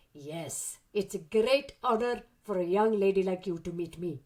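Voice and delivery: put-on a teasing old man in a South African accent. Mandela teasing a young fan, in a South African accent. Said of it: "that was my very good South African accent".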